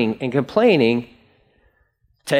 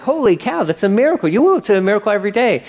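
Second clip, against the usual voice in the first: higher, excited burst of wonder; amazed exclamation; 7 semitones higher, faster than usual. Retold or imitated. Preacher to complaining Israelites, amazed.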